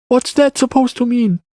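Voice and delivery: put-on high-pitched boy's question; child character. A narrator's reading. Boy questions the Wanderer's remark, high child voice. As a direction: ask back in a high child's voice.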